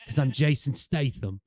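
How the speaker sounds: a terrible Statham attempt; Jason Statham. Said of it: "terrible Jason Statham accent or voice".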